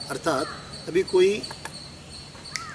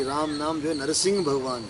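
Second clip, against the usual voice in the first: normal talking voice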